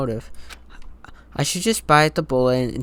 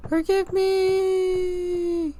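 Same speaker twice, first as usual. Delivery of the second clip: very high, drawn-out mock wail; long squeaky haunted begging; ghostly wailing plea; much slower than usual. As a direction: stretch it into a high, eerie wail. Stretched second take of plea.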